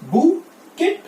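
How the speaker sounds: बुकेट said as bucket, with the t sounded; wrong pronunciation. Shown as a demonstration. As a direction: demonstrate the spelling-based mistake. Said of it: "spelling के हिसाब से". (गलत उच्चारण, बुकेट)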